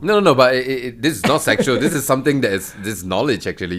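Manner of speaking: insisting loudly, a bit flustered. Real, not acted. Defends himself against teasing.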